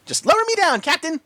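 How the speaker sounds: high, bright calling pony voice; happy Pinky. A narrator's reading. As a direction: call up to him cheerfully. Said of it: "called out happily".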